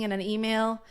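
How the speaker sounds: ordinary talk, nothing marked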